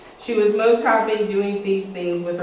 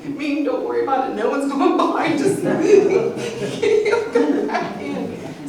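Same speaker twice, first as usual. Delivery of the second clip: laughing reassurance; 7 semitones higher, 5 dB louder than usual. Retold or imitated. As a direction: reassure her while cracking up. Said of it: "And I was like".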